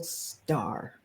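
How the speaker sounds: muttered under her breath, unvoiced; bitter mumble of a rival's name; mumbled name. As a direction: grumble the name to yourself. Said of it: "you just hear her mumble".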